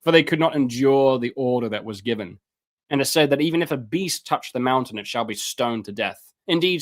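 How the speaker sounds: ordinary talk, nothing marked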